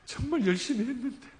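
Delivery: a hint of a sobbing girl; crying daughter. Father voicing his crying daughter, lightly tearful. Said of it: "말을 못하고 울어요".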